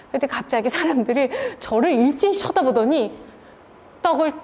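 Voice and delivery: ordinary talk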